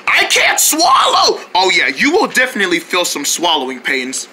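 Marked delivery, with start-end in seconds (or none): 0.1-1.4 high, slow strained cry; choking panic. Acted. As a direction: cry out in drawn-out distress. Choking on the spice.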